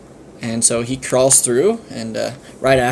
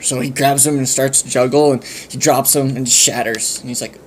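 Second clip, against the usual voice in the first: ordinary delivery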